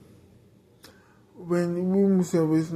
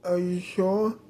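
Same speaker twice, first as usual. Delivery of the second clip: usual speaking voice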